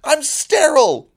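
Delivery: hammed-up frantic character bursting in; frantic denial. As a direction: blurt it out like a guy desperate to clear his name. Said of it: "pounds in, and goes".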